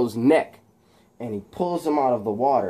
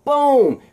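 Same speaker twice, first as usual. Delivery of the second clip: high, loud punched-out "boom"; comic-book kick sound effect; impact boom; 13 semitones higher, 6 dB louder than usual. Acted. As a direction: explode on a single high "boom". Voicing a kick's impact.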